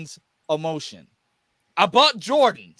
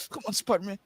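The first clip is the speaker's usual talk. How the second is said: imitating tearful struggle; 3 semitones higher than usual. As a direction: act trapped and tearfully urging yourself on. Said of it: "The best crying was".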